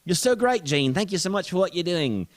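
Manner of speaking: put-on high, loud British-accented thanks; British gushing. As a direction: gush gratefully in a British accent. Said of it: "well, she's British".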